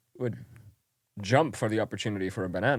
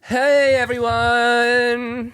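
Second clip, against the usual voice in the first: much higher, louder, slow drawn-out greeting; hammed-up host; 11 semitones higher, 7 dB louder, much slower than usual. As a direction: stretch it out like a goofy announcer. Hammed-up podcast intro.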